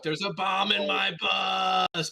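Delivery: slow, high shouted action hero; Keanu impression. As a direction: bellow it like an action movie. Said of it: "I wanted to do an East joke".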